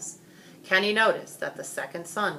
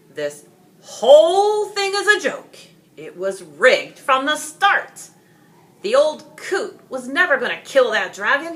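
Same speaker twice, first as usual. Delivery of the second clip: loud, high furious rant; an angry father exposing the rigged fight; angry accusation; 8 semitones higher, 12 dB louder than usual. Acted. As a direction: accuse the whole town in a fury.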